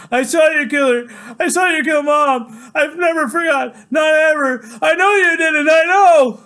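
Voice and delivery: very high, loud, frantic scream; screamed accusation. Acted. Son accusing father of killing Mom, frantic scream. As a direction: scream it out at the top of your voice.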